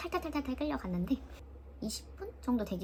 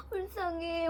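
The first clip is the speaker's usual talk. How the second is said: higher, tearful pity; crying; 8 semitones higher, much slower than usual. Retold or imitated. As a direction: say it through tears. Sister crying along.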